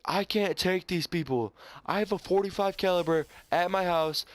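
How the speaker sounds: high, strained imitation of a drunk man ranting; drunk man's rant. Teen retelling a drunk fan's meltdown to viewers, high strained mock rant.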